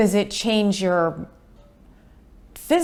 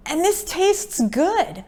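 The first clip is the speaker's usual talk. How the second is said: much higher, louder eager voices; imitating delighted relatives; hammed-up eager family; family's excited praise; 7 semitones higher, 5 dB louder than usual. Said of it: "everybody's like".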